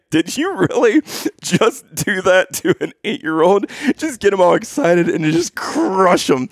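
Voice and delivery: high-pitched, amused mock disbelief; incredulous scolding. Friend to man who tricked an eight-year-old, incredulous. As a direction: retell a friend's appalled reproach. Friend aghast in retold story.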